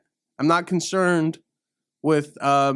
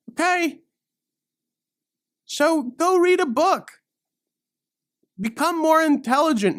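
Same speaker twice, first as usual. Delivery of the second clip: sarcastic; 9 semitones higher than usual. Acted. Sarcastic rant.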